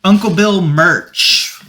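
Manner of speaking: high, slow, drawn-out announcement; announcer voice. Acted. Host announcing the merch to listeners, slow and grand. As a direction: present it like a grand product reveal.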